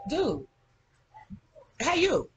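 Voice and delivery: high-pitched call to someone nearby. Real, not acted. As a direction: get someone's attention off camera. Calls to someone off camera.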